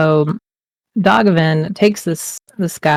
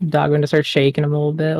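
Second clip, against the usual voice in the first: ordinary talk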